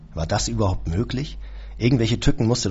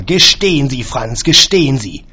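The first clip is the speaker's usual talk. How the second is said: loud, higher, slow demand; bellowing; 5 semitones higher, 9 dB louder than usual. A narrator's reading. Employer roaring at his caretaker to confess, loud and slow. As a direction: roar the order into the receiver. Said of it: "Ich brüllte in den Hörer".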